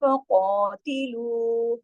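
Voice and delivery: slow, stretched recitation of फ़क़ातिलू with a heavy qaf; slow tajweed recitation. Shown as a demonstration. Teacher reciting the word for students, slow and heavy. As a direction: intone फ़क़ातिलू slowly, qaf thick. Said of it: "फिर यहाँ मोटा पढ़ा जाएगा कॉफ".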